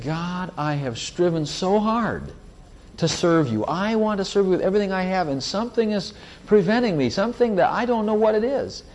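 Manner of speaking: high, rising, pleading cry to God; pleading prayer. Retold or imitated. Retold desperate prayer of organist.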